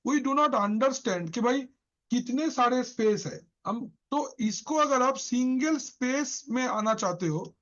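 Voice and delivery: ordinary talk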